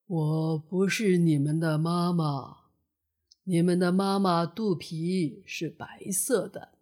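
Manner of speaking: big turtle. A narrator's reading. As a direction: speak low and unhurried as an old-style storybook turtle. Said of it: "大乌龟笑着说". (大乌龟低沉、慢悠悠地说)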